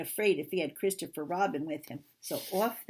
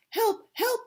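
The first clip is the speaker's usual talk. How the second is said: high, loud panicked cries; squealing alarm, very high and loud; terrified Piglet; 6 dB louder than usual. Acted. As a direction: cry for help in a tiny frightened voice. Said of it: "cried Piglet".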